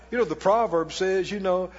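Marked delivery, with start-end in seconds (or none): none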